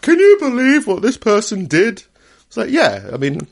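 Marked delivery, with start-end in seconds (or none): 0.0-2.0 much higher, louder mock outrage; mock outraged gossip. Host mimicking people outraged at a crime, mock gossip. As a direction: squeal it like a scandalized gossip. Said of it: "when people start like".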